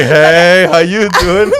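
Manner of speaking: playful, exaggerated come-on with a laugh; mock flirting. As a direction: ham up hitting on someone. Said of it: "You're not hitting on the imp".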